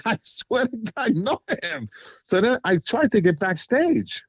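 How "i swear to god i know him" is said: higher, pleading emphasis; earnest insisting. Retold or imitated. Swearing to doubting friends that he knows him, high and insistent. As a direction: make them believe you.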